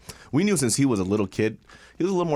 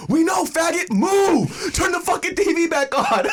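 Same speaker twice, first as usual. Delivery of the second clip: angry yell; 4 dB louder, slower than usual. Retold or imitated. Brother yelling at coming-out brother.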